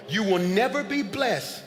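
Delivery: slow, deliberate, word by word; slow down on "You will never be blessed."; said slowly. Shown as a demonstration. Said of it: "I'ma say it again slow".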